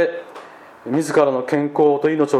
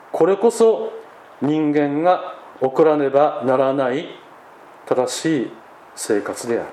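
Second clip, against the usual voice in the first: solemn dramatic reading; 5 semitones higher than usual. Acted. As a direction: read it like a mournful testimony. Reads memoir text aloud. (厳粛な朗読)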